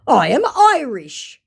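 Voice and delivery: a hint of a proud scammer; mock scammer claim. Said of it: "he claims".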